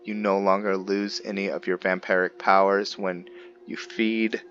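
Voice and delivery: usual speaking voice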